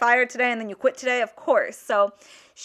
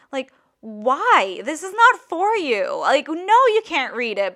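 high-pitched mock protest; swooping, exasperated retort; exasperated objection; 4 semitones higher, 3 dB louder, slower than usual. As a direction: push back, indignant and incredulous. Objecting to manager reading letter.

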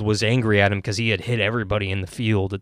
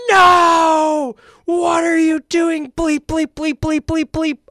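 high-pitched, loud cry of dismay, then rapid bleeps; anguished outburst; 6 dB louder, slower than usual. Retold or imitated. Dad shouting as his son picks the bottom lane, loud anguished cry. Jeff reacts to son's lane pick.

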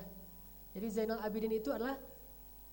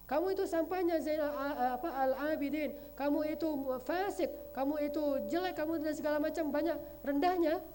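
raised, louder scornful insults, only a touch acted; insulting sneer; 6 semitones higher, 5 dB louder than usual. Spiteful man insulting Ali Zainal Abidin in the mosque, raised mock scorn. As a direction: spit out the insults at him. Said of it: "Apa kata laki-laki ini?".

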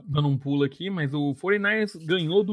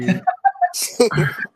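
embarrassed laugh; 6 semitones higher, 6 dB louder than usual. Real, not acted. Laugh after choking fit.

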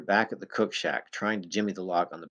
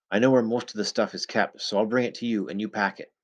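ordinary delivery, nothing marked

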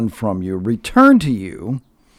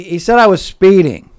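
louder husband repeating; 8 semitones higher, 8 dB louder than usual. Retold or imitated. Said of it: "So he".

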